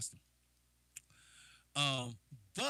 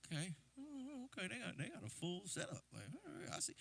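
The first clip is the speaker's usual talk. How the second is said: impressed inner voice; 7 semitones higher, 10 dB quieter than usual. Retold or imitated.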